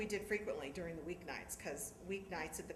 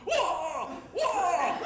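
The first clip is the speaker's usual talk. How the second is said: loud, high, laughing whoa; father yelling whoa; 9 semitones higher, 10 dB louder than usual. Retold or imitated. Father chasing the convertible driver, loud laughing yell. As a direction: holler after the convertible.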